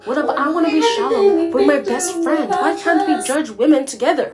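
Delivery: high and loud whining plea; whiny friend. Retold or imitated. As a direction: beg like a needy best friend. Mauricio begging his friend.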